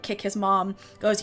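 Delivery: ordinary delivery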